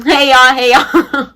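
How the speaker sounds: bright, sing-song mock-cheerful hey; a touch of petty cheer; breezy greeting. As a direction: greet everyone sweetly, snubbing him.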